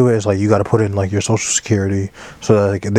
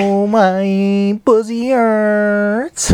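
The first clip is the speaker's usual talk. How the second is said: high, drawn-out comic whine; mock wailing catchphrase; 11 semitones higher, much slower than usual. Cohost opens podcast with new phrase, slow high mock wail. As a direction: stretch each word in a high, silly moan. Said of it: "trying to start it off like this".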